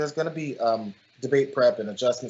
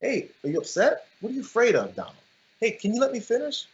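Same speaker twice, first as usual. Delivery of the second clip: higher, sweetly condescending mock concern; patronizing taunt; 8 semitones higher than usual. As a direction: talk down to him like a sulking child. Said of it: "like, patronizing".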